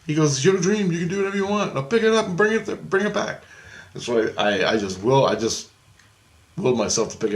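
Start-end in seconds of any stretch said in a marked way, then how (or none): 0.3-3.4 high, quick coaxing voice; Jesus urging. Retold or imitated. Jesus telling the dreamer to lift the car, high and pushy. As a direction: urge him on like a cheerful coach.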